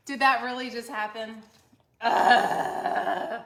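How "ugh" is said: disgusted ugh. Real, not acted. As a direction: groan loudly, grossed out. Sauce all over her sweater.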